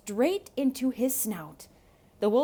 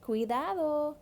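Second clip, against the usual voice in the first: higher mother's voice calling; mama calling out; 7 semitones higher than usual. Acted. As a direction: call after him like a worried mom. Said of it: "called his mama".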